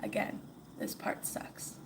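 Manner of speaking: hushed whisper to the listener. Real, not acted. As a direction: breathe it out, barely voiced. Aside after the sad scene.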